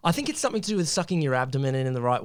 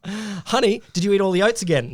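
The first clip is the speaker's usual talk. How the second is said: higher, louder nagging partner voice; scolding spouse; 7 semitones higher, 5 dB louder than usual. Acted. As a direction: nag like a fed-up partner.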